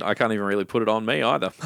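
usual speaking voice

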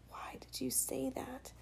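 whispered, slow and hurt; hushed. Acted. A silent look asking why, whispered. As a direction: breathe it, barely a sound. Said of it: "His eyes said".

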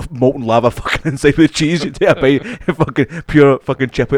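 excited rave. Real, not acted. Mate gushing to mate about chippy cheese, excited and loud.

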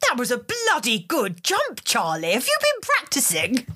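high, drawn-out posh officer voice; high comic impression of a stiff officer; Montague impression. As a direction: ham up the commanding officer. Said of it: "talks in a voice like this".

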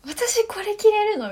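excited disbelief. Retold or imitated. Thrilled at costume design.

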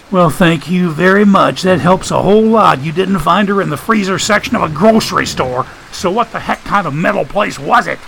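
high, exasperated mock thanks; high-pitched fed-up character voice; sarcastic Joshua.

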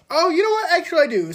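high bright realization; sudden excitement. Real, not acted. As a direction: pop up with the idea. Sudden idea.

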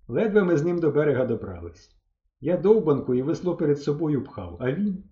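usual speaking voice

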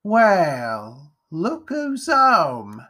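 high, very slow, drawn-out greeting; mocking mother. A narrator's reading. Mother greets fleeing Jack at home, mocking. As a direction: stretch every word with sly relish.

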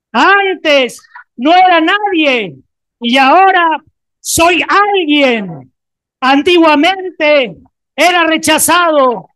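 loud, slow, drawn-out proclamation; shouted declaration. Acted. Preacher modelling the declaration for the congregation, shouting slowly. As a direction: shout it for the whole church to hear. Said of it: "vas a gritar".

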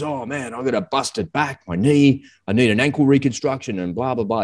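lightly voiced whinge about injuries; complaining soldier. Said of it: "And he goes".

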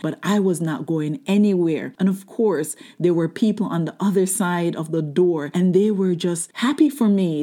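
ordinary delivery

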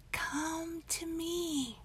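high, very slow, airy tree call; whispering trees. Acted. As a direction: beckon slowly like a distant tree. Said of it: "you can hear them saying".

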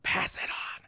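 voiceless mock whispering. Mocking kids passing a whisper down the row, voiceless. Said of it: "they're like".

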